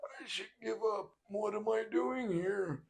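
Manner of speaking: put-on high quavering old man, slow and quiet; whimpering old man giving up; high, slow, shaky elderly whine; mock senile Biden. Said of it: "it's like".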